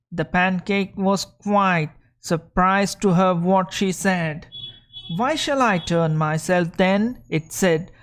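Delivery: reading a fairy tale aloud to the class; lively storybook read for learners; story reading. Acted. Teacher reading a story to students, lively storybook voice.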